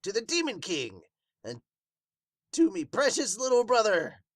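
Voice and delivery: high, loud battle cry; rallying cry. Acted.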